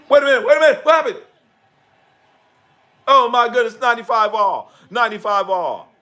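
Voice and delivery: real shock at the score; excited outburst. Real, not acted. Reacting live to the game.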